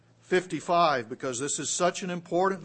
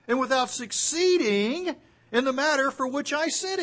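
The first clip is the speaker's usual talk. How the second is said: fervent scripture reading; 6 semitones higher, 5 dB louder than usual. Acted. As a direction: build the verse, higher at the end. Reading scripture with rising fervor.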